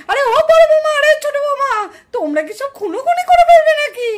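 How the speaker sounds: high, loud alarmed calling; panicked shout. Acted. Mother-in-law shouting at both daughters-in-law to stop. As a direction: cry out to stop the fight.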